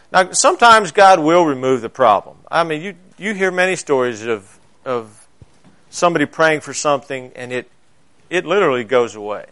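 ordinary delivery

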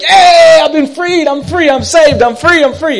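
high, loud yell of joy; joyful shout. Retold or imitated. Driver yelling to strangers at a stop sign, joyful shout. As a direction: shout it out to the whole street.

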